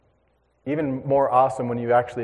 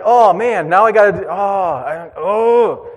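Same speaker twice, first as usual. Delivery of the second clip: loud, high "oh man" of dismay; dismayed groan; 5 semitones higher, 6 dB louder, slower than usual. Retold or imitated. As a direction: moan over the lost catch. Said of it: "I'm like".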